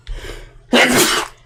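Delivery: loud breathy sneeze; sneezing. Real, not acted. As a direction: sneeze hard, unvoiced burst. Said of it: "Sorry for my sneezing".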